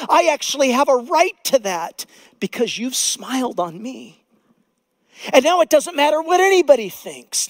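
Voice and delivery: high, loud prayer building in fervour; fervent prayer from the pulpit; preached prayer. Acted. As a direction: pray it out boldly to the Lord. Voices a believer's prayer.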